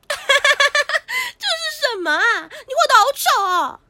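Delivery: loud, drawn-out wail; crying. Acted. Girl upset at an ugly portrait, crying loudly. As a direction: bawl the words out in complaint. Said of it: "别哭，别哭".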